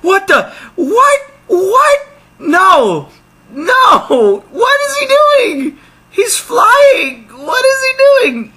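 very high, loud disbelieving yells; shocked shouting. Real, not acted. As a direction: scream out in disbelief. Reacts to dolphin flying in game.

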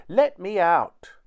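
high, loud, drawn-out demand; little captive shouting to be freed; tiny-voiced shout. A narrator's reading. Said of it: "shouted Tom's teeny tiny voice".

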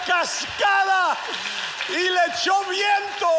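high, loud shouted preaching, stretching key words; preaching climax. Acted. Preacher to the congregation about Jesus raising the son, in a full-force shout. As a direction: shout the good news to the whole church.

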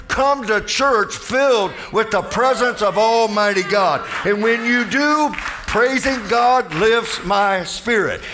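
rising preacher's cadence, slowing on each line; preaching. Acted. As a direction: build the crowd up line by line. Climax of the sermon.

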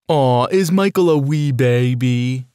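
put-on slow, sing-song baby talk taunt; mocking tease. Friend taunting Michael with mock baby talk. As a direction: coo it at him like he's an infant. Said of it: "teased one of my friends".

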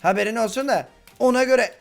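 usual speaking voice

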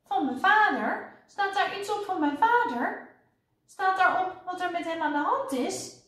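high, eager questioning; urgent child. A narrator's reading. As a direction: press anxiously for news of father. Child eagerly asking about her father.

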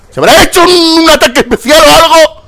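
high, loud, incredulous yelling; baffled shouting. Real, not acted. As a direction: shout it in total disbelief. Baffled at a kill in game.

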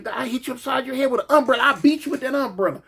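high, fast boasting woman; sister's voice. Retold or imitated.

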